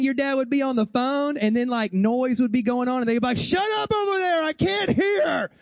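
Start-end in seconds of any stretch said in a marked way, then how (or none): 3.4-5.5 high, drawn-out exasperated mock shout; yelling dad. Dad on the phone over noisy kids, yelling. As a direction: holler over the noise, stretching the last words. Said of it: "remember your dad, same thing".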